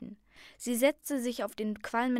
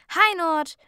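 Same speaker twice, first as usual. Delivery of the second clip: high, loud happy call; called greeting; 8 semitones higher, 12 dB louder than usual. A narrator's reading. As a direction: call out to a friend across the coach. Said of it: "rief Ruby".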